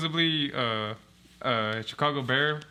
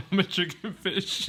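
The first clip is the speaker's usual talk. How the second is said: laughing teasing repeat; 6 semitones higher, slower than usual. Pretend. Laughing, mocking friend's phrase.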